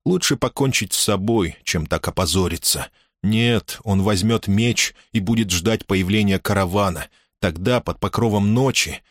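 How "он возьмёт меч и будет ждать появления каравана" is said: plain reading voice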